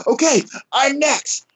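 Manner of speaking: hammed-up lifter begging for a turn; imitated eagerness. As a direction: pitch it way up, eager to go next.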